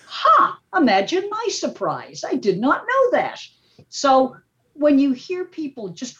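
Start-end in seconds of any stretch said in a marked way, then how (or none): 0.1-3.4 high, incredulous disbelief; mock surprise. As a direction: play dumb, amazed at yourself. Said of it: "I'm like".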